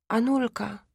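hushed, asking her consent; questioning whisper. A narrator's reading. Father whispering to his sick wife, asking her. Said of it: "szepnął ojciec pytająco".

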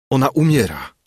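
mildly desperate exclamation; crying out. A narrator's reading. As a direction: call it out while rushing towards him. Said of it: "zawołałem".

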